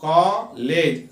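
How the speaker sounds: कॉलेज stretched slowly before spelling; slow spelling read. Shown as a demonstration. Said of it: "तो हम उसे कैसा याद कर सकते हैं".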